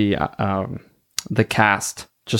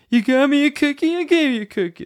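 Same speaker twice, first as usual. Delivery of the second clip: imitating Nick; faster than usual. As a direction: squeal it like a fed-up Nick. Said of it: "That's Nick".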